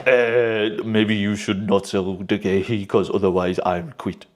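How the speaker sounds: low, soft, slow put-on foreign manager accent; second Glasner accent try. Glasner asking not to sell the player, soft low mock accent. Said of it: "I don't know how does Glasner talk".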